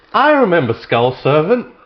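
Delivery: high, loud laughing quote; laughing disbelief. Retold or imitated. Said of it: "And I was like".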